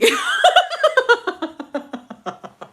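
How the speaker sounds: high, loud burst of laughter; big laugh. Real, not acted. Laughing at her own wifey line. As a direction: crack up at her own joke.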